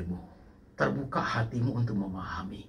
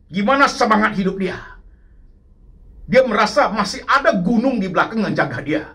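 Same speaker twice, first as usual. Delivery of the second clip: much higher and louder, pressing the congregation; preaching at full force; 7 semitones higher, 7 dB louder than usual. Acted. Preacher rousing the congregation about a father's love, loud and high. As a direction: hammer it home from the pulpit.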